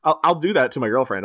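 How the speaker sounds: normal talking voice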